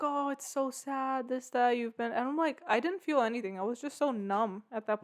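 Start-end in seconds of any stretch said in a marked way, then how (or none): 0.0-2.1 slow, higher sing-song pity, lightly mocking; mimicking friend. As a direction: drag out the sympathy in a friend's voice. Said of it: "They're like".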